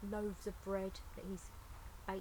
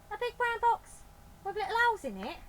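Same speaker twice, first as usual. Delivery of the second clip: high, loud amazed echo; can't believe her ears; astonished Betty; 13 semitones higher, 11 dB louder, faster than usual. Acted.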